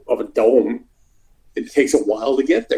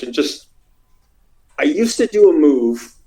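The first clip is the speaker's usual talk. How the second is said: usual speaking voice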